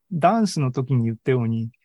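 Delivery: ordinary talk, nothing marked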